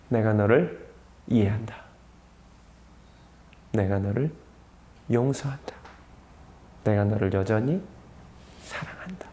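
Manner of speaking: soft, low, tender voice with long pauses; gently reassure, fading to a whisper; tender reassurance. Retold or imitated. Jesus' loving eyes speak to Peter. (낮고 부드럽게 다독이듯)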